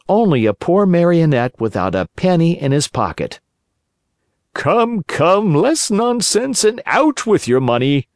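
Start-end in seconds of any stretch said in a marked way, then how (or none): 4.5-8.1 high, pushy robber's demand; bully the puppet for money; threatening thief voice. A narrator's reading. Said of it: "cried the two thieves in threatening voices".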